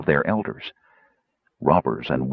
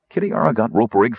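usual speaking voice

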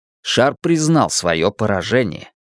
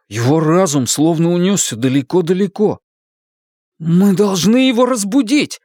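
higher, anxious urgent cry; alarmed; 6 semitones higher than usual. Acted. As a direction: plead in rising panic. Panicked boys try to wake Roland.

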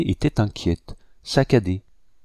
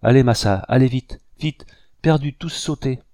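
loud, higher-pitched panicky urging; terrified urging; 5 semitones higher, 6 dB louder than usual. A narrator's reading. Jailer urging the captain before the blast, terrified. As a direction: urge them on in alarm, voice raised. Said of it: "dont les dents claquaient de terreur".

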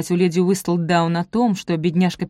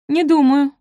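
high, louder, hurried-sounding denial; hasty denial; 8 semitones higher, 6 dB louder than usual. A narrator's reading. Софи hastily denies having met Пенелопа before, voice jumping up. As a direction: deny it at once, voice raised. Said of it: "поспешно ответила она".

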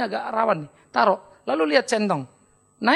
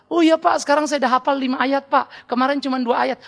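raised, eager voice of a show-off memorizer; mock proud student; 6 semitones higher, 4 dB louder than usual. Congregant boasting to pastor about memorized verses, eager raised voice. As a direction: play the churchgoer boasting to the pastor.

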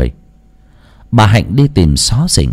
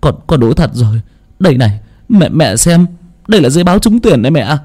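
tearful joy; 6 semitones higher, faster than usual. Acted. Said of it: "Thằng Phát cũng rưng rưng nước mắt".

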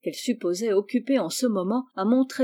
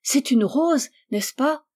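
high, eager question; delighted question; 5 semitones higher than usual. A narrator's reading. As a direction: ask in wonder, voice lifted. Delighted girl watching fireworks. (question émerveillée, voix haute)

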